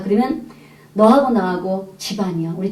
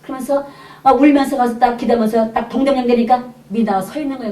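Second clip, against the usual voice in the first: usual speaking voice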